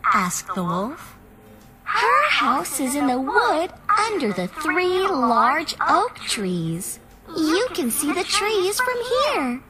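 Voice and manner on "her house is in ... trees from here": put-on little girl voice. A narrator's reading. Little Red answers the wolf.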